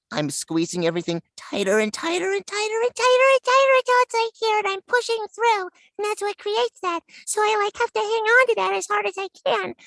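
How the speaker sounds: Cute Mon voice. Shown as a demonstration. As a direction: clench the throat like a fist and push a tiny high voice through. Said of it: "like, for Cute Mon".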